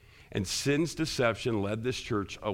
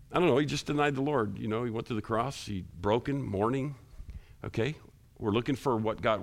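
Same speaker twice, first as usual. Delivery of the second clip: ordinary talk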